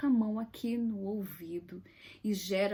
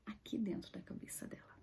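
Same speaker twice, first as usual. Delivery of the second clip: whisper; 13 dB quieter, faster than usual. Real, not acted. Stressing the child's inner suffering.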